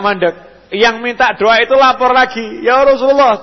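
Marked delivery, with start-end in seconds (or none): 2.6-3.4 raised, higher cry for help; pleading villager. Retold or imitated. Villager cries out to the Prophet about the flood, raised pleading. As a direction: beg the Prophet urgently.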